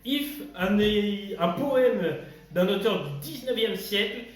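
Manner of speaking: video intro. Acted. Host greeting viewers and announcing the poem, upbeat video intro. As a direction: punch out the welcome like a host.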